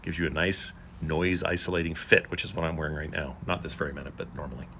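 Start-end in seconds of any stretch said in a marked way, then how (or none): none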